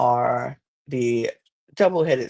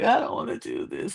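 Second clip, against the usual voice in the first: higher-pitched imitation of whining; mock crying whine; 4 semitones higher, 4 dB quieter, faster than usual. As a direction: whine it like a tearful contestant. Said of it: "She's crying out the gate".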